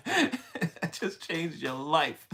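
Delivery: slow, laughing through the words; laughing repeat. Real, not acted. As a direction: giggle your way through the line. Repeats the line while laughing.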